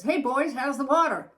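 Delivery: put-on breezy call from a wise old fish. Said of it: "the old fish says to the".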